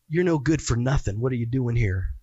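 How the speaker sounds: low devil voice jeering at the sinner; the devil sneering. Retold or imitated. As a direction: growl it down low like the accuser mocking. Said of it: "that's the devil".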